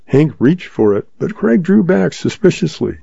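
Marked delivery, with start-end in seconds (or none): none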